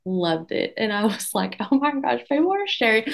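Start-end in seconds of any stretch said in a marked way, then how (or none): 1.6-3.0 higher-pitched gasp of disbelief; surprised delight. Retold or imitated. Retelling her surprise at people sharing her reel, higher and thrilled. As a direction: squeal it as if you can't believe it.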